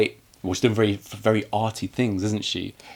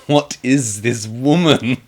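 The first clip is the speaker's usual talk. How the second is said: laughing disbelief; 3 semitones higher, 3 dB louder, slower than usual. Retold or imitated. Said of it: "just thinking".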